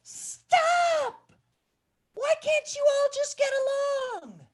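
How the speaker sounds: a tiny creature begging them to stop; little tiny voice. Acted. Smallest warthog pleads with the quarrelling animals, high and loud. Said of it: "this little tiny voice".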